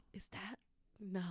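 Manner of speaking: very soft retold double take; hushed disbelief. Spotting hated teacher at Walmart, hushed disbelief. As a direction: say it low, under your breath.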